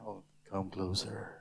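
low, hushed tempter's voice; luring serpent. Acted. Snake tempting Eve up close, low and luring. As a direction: draw them in, low and soft.

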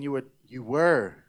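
"were" leaned on and held; stress on were. Shown as a demonstration. Preacher teaching the room the past tense, stress on "were". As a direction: land firmly on "were". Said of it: "everybody say "were".

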